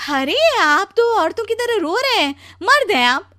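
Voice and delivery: high, loud scolding wife; shocked chiding. Acted. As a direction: chide a crying husband, loud and shrieky-high. पत्नी रोते पति को ताना देती. (ऊँची आवाज़ में हैरानी भरा ताना)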